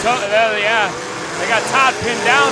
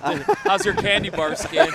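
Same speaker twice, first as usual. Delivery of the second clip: laughing quote; 8 semitones higher, slower than usual. Retold or imitated. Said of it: "Tell me, like".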